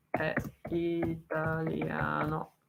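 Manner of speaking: very slow, stretching each syllable of italiano; slow, spelled-out italiano. Shown as a demonstration. To a foreign viewer, drawn-out italiano. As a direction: say italiano slowly so a foreigner gets it. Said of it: "parlerò più piano".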